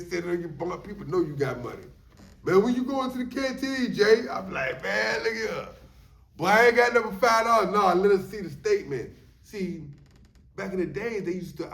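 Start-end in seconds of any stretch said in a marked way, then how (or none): 2.4-4.3 mock pushy call. Inmate pressing him for canteen. 4.8-9.1 mock loud brush-off. His retort to canteen request.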